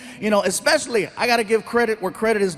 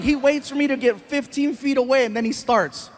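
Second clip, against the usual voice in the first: usual speaking voice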